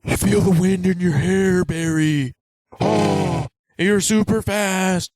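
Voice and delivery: lightly voiced dreamy mentor; mock mentor. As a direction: coax it with a hint of a slow mentor voice. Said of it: "And he's like".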